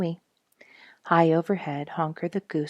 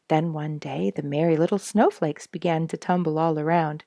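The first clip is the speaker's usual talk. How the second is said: usual speaking voice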